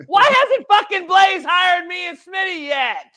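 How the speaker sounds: loud, high, slow disbelief; incredulous shout. Retold or imitated. Retelling seeing Alex on Blaze, shouting in disbelief. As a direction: yell it in stunned outrage. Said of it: "and I'm like".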